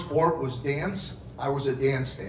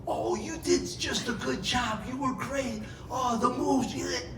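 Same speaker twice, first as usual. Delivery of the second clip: gushing praise voice; 9 semitones higher, 4 dB quieter than usual. Retold or imitated. People praising him, mock voice.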